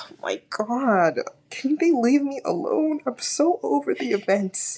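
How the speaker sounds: slow, weary whisper-sigh into a high whine; sighing, over it; exasperated. Real, not acted. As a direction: drag it out, fed up. Exasperated sigh about the show.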